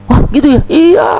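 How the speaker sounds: high, loud shocked exclamation; mock alarm from an old woman; dismayed surprise. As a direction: gasp it out in disbelief. Grandmother tells Aisyah, dismayed.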